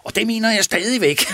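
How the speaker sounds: can't keep a straight face; laughing. Real, not acted. Quoting the report, laughing.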